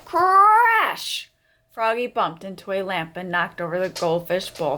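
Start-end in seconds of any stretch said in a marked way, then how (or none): 0.1-1.3 high, loud crash word. A narrator's reading. Reading the lamp crash to kids, loud and high. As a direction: burst out with the crash.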